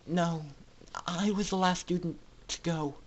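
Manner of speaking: mildly sobbing student admits she was the last; speaking through sobs. Acted. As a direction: hold back tears, quietly. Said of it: "Scootaloo spoke in sobs".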